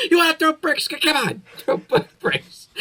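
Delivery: mock high-pitched yell; high, loud movie-bandit taunt, slowing at the end; imitating Marv. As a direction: imitate a bandit daring someone to throw bricks. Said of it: "he's like".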